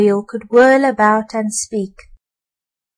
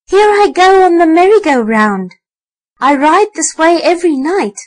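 high, loud squeaky rat voice; 6 semitones higher, 5 dB louder than usual. A narrator's reading. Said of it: "squeaked the rat".

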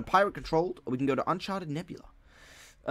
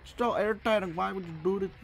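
high whiny complaining; 10 semitones higher, 4 dB louder than usual. Real, not acted. Shield lost, whining complaints.

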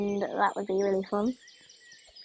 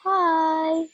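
excited greeting; 5 semitones higher, 5 dB louder than usual. Real, not acted. Greeting the new horse.

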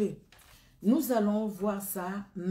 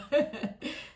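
short laugh; 7 semitones higher than usual. Real, not acted. Laughs at a glitch.

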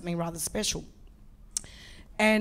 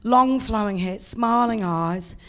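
ordinary talk

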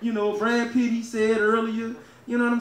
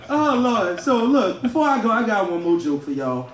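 usual speaking voice

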